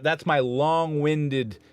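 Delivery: ordinary talk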